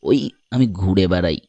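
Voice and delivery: low, easy-paced man's voice; wandering stranger. Acted. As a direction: introduce yourself in a deep voice. Stranger describes himself. (পর্যটকের নিচু, ধীরস্থির গলা)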